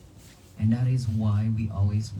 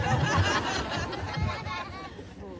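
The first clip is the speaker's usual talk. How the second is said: soft breathy chuckle; a quiet rueful laugh at the memory; gentle unvoiced laugh; airy laugh; 6 dB quieter than usual. Real, not acted. Laughs at mother's reaction.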